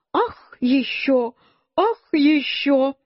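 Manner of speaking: slow, drawn-out exclaiming; delighted cries. A narrator's reading. Mother crying out at each new mushroom, drawn-out excited exclaiming. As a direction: exclaim with mild delight, stretching each word. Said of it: "А мама всё кричала".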